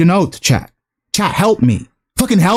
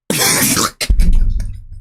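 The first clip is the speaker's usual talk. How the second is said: wheezing, voiceless laugh; disbelieving laugh. Real, not acted. Streamer breaking up over the statement, wheezy laughter. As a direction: crack up without voice.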